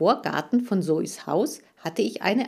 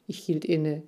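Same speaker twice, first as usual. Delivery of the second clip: usual speaking voice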